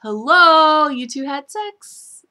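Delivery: loud, high drawn-out sarcastic hello. Real, not acted. Viewer scolding EJ and Sammy, sarcastic and slow. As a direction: call out the obvious, slow and mocking. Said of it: "And it's like".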